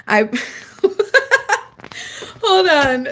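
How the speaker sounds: laughing through the words. Real, not acted. Streamer cracking up at a funny chat message, laughing. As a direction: crack up mid-phrase.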